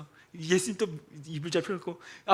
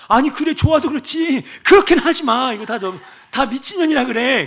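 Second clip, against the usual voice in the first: higher, louder scolding; exasperated pastor; 9 semitones higher, 9 dB louder than usual. Retold or imitated. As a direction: scold her in disbelief.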